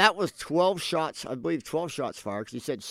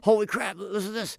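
raised-pitch retold exclamation; mock amazement; 5 semitones higher than usual. Co-host recalls first weeks of shooting calls, mock amazement. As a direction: replay your early shock at the calls. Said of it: "I was like".

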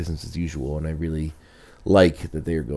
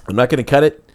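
higher, louder playful declaration; 8 semitones higher, 11 dB louder, faster than usual. Real, not acted. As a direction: announce it with a grin. Joking about the yawn.

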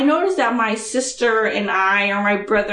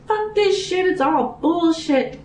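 high-pitched pretend outburst; hammed-up fed-up rant, drawn out at the end; mock rant; 7 semitones higher than usual. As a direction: act out storming in fed up. Acts out ranting in boss's cube.

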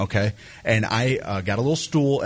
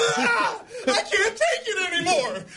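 very high, drawn-out mock wail; hysterical outburst; slower than usual. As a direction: imitate a friend cracking up, squealing slowly. Retold friend's hysterical outburst.

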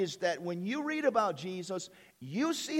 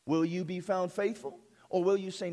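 ordinary talk, nothing marked

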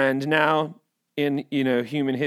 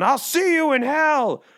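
higher, louder parting shout; hammed-up farewell cry; mock defiant yell; 11 semitones higher, 4 dB louder than usual. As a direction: fling the line at someone as you flee the cold. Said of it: "you wanna tell someone".

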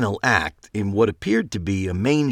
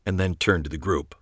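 plain reading voice

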